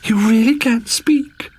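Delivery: put-on high, slow, breathless girlish wonder; princess delight. Princess amazed at talking frogs, high and breathy.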